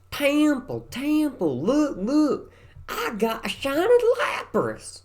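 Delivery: put-on excited kid, high and loud, in thick West Virginia twang; thick childhood country accent. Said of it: "an attempt at recreating my old accent".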